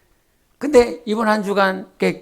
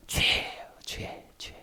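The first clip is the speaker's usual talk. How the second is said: whispered reveal; 17 dB quieter, slower than usual. Real, not acted. Whispered answer to question.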